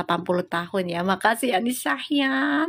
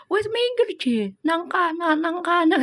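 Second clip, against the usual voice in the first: high-pitched mock grumble with Javanese "nang kana"; 7 semitones higher than usual. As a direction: ham up the boyfriend's nagging. Boyfriend grumbling, Javanese words.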